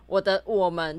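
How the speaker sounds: usual speaking voice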